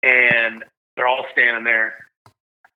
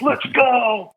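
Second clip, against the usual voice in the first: excited scream; 12 semitones higher than usual. Retold or imitated. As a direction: yell it out like you just won. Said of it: "I'm, like, screaming".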